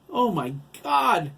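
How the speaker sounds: high, loud exclamation; horrified cry. A narrator's reading. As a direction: cry out in revulsion. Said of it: "J T cried".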